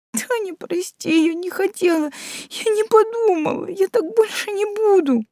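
very high crying apology; tearful gabbling. A narrator's reading. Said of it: "горячо зачастила".

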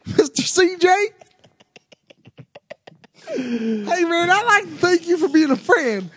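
hammed-up podcast host impression, emphatic. Thanking listeners and handing to C J, hammed-up host voice. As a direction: sign off like an effusive show host. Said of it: "doing the impersonations of C J and B K".

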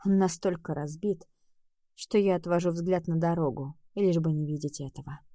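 ordinary delivery

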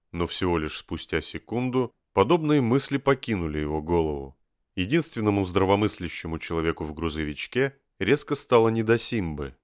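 usual speaking voice

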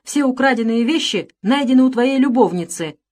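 agitated, rising accusation; hollow, breaking shout. A narrator's reading. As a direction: shout down the denial. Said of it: "крикнул глухим, срывающимся голосом".

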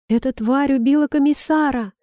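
high shout that cracks; shouting, voice breaking. A narrator's reading. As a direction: yell it till the voice breaks. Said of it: "закричал Катков, но голос его сорвался".

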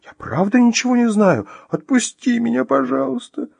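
very high, tearful begging; sobbing plea. Acted. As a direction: beg to be let go through tears. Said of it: "всхлипнула Вероника, давясь слезами".

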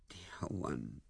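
soft, low endearment; tender. Acted. Lewisham comforts the crying Ethel, tenderly. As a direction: soothe her gently in a hushed voice.

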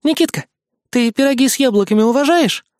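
high, bright friendly fox voice. A narrator's reading. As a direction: break the tension sweetly. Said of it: "разрядила обстановку Лисса".

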